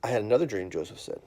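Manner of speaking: put-on loud, lively storybook reading to a child; reading to children. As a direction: read it big and animated for the kids. Reads a children's Bible story.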